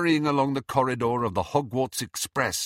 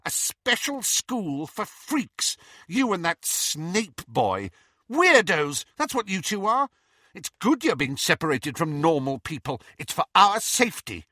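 high-pitched gloating taunt; with relish; 8 semitones higher, 3 dB louder than usual. A narrator's reading. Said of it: "said Petunia with relish".

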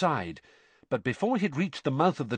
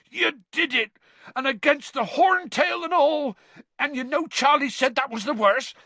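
high, moved congratulations; proud and choked up; emotional Hagrid; 4 dB louder than usual. A narrator's reading. Hagrid congratulating Harry after the dragon, moved. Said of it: "said Hagrid hoarsely".

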